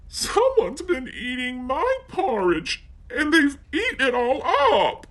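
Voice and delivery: put-on very high little baby bear voice; Baby Bear finds his bowl empty, tiny and high; teeny tiny voice. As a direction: squeak it out as the smallest bear. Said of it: "he cried in his teeny tiny voice".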